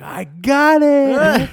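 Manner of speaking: very high, loud, drawn-out squeal of triumph; shaking with excitement. Retold or imitated. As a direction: squeal slowly like you just won the prize. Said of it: "I'm shaking".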